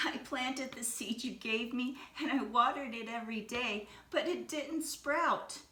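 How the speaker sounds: put-on a child explaining while starting to cry, slowing on the worst part; tearful plea. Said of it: "Ping started to cry and replied".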